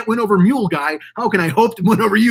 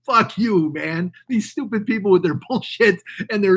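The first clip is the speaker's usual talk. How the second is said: normal talking voice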